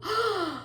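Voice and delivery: big breathy intake; sharp gasp of wonder; amazed gasp. Pretend. Teacher amazed by the rooster sound, gasping.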